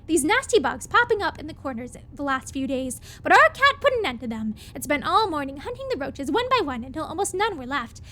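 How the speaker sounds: normal talking voice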